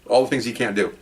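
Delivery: ordinary delivery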